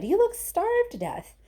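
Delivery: excited Wonka. A narrator's reading. Wonka urging Charlie.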